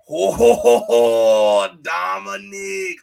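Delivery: loud, cocky laughing wrestler voice, last word drawn out slow; mock a smug wrestler's entrance cackle; Finn Balor impression. Mocking a wrestler's cocky promo voice to his cohost, loud and smug. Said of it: "Finn Balor try to be cocky with his voice".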